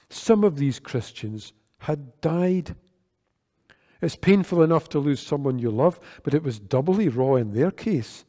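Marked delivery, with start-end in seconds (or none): none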